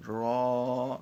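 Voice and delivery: را said full and thick, slow and stretched; full heavy letter. Shown as a demonstration. Teacher showing students a heavy را, slow and full. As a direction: give را a heavy, full-mouthed r. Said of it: "موٹا پڑھنا ہے".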